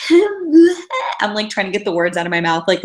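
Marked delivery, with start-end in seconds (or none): 0.1-0.8 calling out. Retold or imitated. Trying to call after him.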